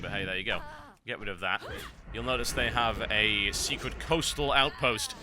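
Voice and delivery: ordinary talk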